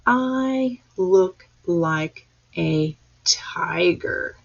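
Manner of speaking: slow whispered word-by-word read; slow pointing read. Shown as a demonstration. Teacher reading aloud with class: slow, word by word. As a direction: read each word slowly as you point. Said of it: "let's read that again".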